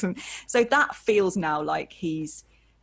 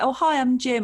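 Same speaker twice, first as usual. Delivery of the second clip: friendly greeting; 7 semitones higher, 5 dB louder than usual. Retold or imitated. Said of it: "he was like".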